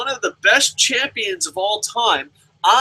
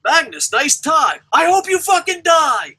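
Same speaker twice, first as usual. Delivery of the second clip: mock taunting yell; 7 semitones higher, 3 dB louder than usual. Mock fan rhyme to Magnus.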